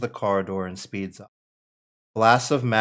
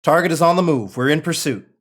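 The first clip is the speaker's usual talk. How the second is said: clipped pursuit call; 7 semitones higher, 7 dB louder than usual. Acted. Pursuing Sentinels call over radio.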